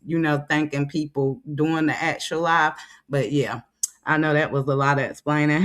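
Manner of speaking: normal talking voice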